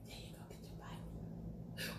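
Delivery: soft unvoiced whisper, slowed down. Real, not acted. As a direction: whisper it as if offering a bite. Whispering while offering a bite.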